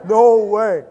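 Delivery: loud, high, put-on voice of Nate; Nate's way of saying it. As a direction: repeat his loud surprised no way. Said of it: "That's how he says it".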